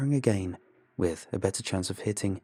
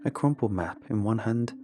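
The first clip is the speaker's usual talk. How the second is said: plain reading voice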